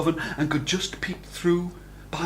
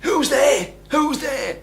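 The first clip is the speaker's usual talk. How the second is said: high wife's call; 12 semitones higher, 4 dB louder, slower than usual. A narrator's reading. Wife answers the door.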